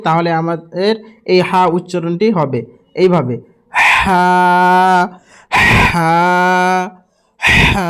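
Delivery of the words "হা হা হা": হা pushed with air from deep in the throat; breathy deep ha. Shown as a demonstration. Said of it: "হাওয়া বের করবেন মুখ থেকে". (গলার ভিতর থেকে হাওয়া দিয়ে হা)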